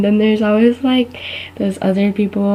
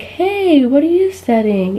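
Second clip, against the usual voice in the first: friendly stranger; 5 semitones higher, slower than usual. Retold or imitated. Stranger greets her mid-cookie.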